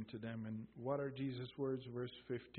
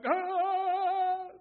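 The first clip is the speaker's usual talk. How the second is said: sharp, very high mock shriek of fright; imitated scream; 13 dB louder than usual. Preacher mimicking frightened disciples in the boat, a high mock scream. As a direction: let out the disciples' terrified cry. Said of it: "You scream, and they scream".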